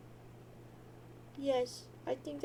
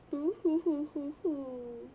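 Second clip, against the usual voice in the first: mock sobbing; slower than usual. Mock crying over John.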